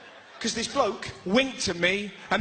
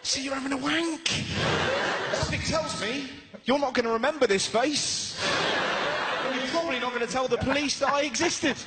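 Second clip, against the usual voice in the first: mock burglar; 6 semitones higher than usual. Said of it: "He's obviously going".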